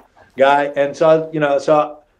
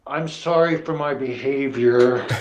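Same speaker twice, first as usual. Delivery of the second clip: forced apology through teeth; 3 semitones lower, 4 dB quieter, slower than usual. Retold or imitated. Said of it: "my teeth are gritting".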